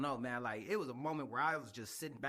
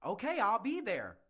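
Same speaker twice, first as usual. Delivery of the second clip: desperate recruiter call; 5 semitones higher than usual. Retold or imitated. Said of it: "you calling kids up like this".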